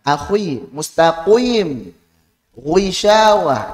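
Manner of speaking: mustaqim, ghuishawah drawn out slowly and over-thick; slow wrong demo. Shown as a demonstration. Teacher slowly demonstrating an over-thick mistake. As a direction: stretch ghuishawah slowly with too much weight.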